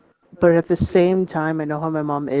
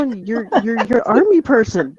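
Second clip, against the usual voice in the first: exasperated protest; 6 semitones higher than usual. Retold or imitated. Scene: kid arguing with mom over which game is worse, incredulous. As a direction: argue it out like a baffled kid, pitched up.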